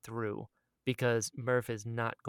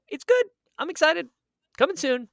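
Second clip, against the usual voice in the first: high, loud burst of hype; excited; 9 dB louder than usual. Real, not acted. Hyping the trailer to listeners, excited. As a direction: squeal it with excitement.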